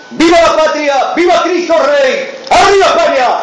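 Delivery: high, shouted rally cries; booming balcony speech. Acted. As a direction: rouse the crowd, full shout.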